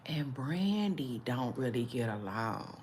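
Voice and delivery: hushed gossip whisper. Real, not acted. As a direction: drop to a secret whisper.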